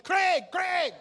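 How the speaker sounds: high, loud shout after him; calling out. Retold or imitated. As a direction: yell the name down the hall.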